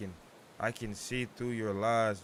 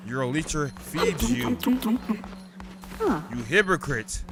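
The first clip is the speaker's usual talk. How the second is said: higher, louder, slow rebuke; mock indignant scold; 5 semitones higher, 8 dB louder than usual. Cult leader rebuking a hypocrite follower, indignant. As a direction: scold the ungrateful one.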